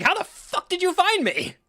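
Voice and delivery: high, loud, drawn-out outrage; acted shocked yell. Acting how the Avatar should confront Iroh, shocked yelling. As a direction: yell it slowly in utter disbelief. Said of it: "he should be like".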